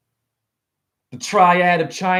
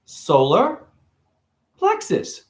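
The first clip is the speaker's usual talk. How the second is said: slow, separated words; slower; 6 semitones higher, slower than usual. Pretend. Explaining a word to a kid, slowly. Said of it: "Say it slower".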